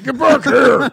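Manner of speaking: high loud chase yell, laughing; shouting. Retold or imitated. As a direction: yell after someone, laughing. Imitating a film chase yell.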